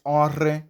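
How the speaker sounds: "onre" with a trilled r; rolled R example. Shown as a demonstration. Teacher giving the first example, rolled r in onre. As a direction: roll the r in "onre". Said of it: "it is the R O H digraph".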